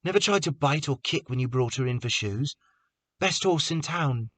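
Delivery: sympathetic. A narrator's reading. Prentice consoling Carter softly.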